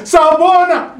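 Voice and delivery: Sawubona called out high and bright; greeting the congregation to get an echo. Shown as a demonstration. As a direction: call Sawubona to the crowd. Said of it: "I want to hear the echo".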